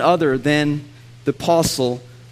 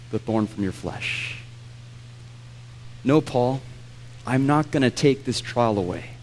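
lower, softer tender voice of God; God speaking gently; 5 semitones lower, 7 dB quieter than usual. Acted. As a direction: gently tell Paul no, then promise grace. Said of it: "way of God telling Paul".

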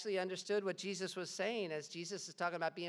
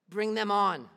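loud, higher bold challenge; loud defiance; 4 semitones higher, 10 dB louder, slower than usual. Acted. Polycarp defying the governor, loud and bold. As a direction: throw the dare back at him.

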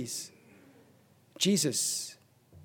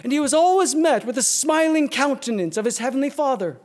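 higher and louder, rising preacher's cadence; preaching at full force; 8 semitones higher, 5 dB louder than usual. Acted. Preacher to congregation, building up, full-force preaching. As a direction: build the congregation toward a promised blessing.

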